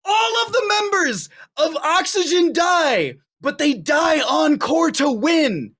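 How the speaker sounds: screaming in disbelief, very high; shrieking jackpot winner. Real, not acted. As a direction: shriek like you just won big. Caster stunned by the finish.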